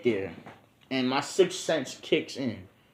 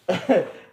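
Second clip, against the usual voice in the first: laugh; 8 semitones higher, 8 dB louder than usual. Real, not acted. Laughs at own joke.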